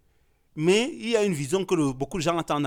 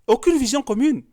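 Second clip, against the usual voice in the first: indigné; 10 semitones higher, 6 dB louder than usual. Real, not acted. Conclusion indignée, forte.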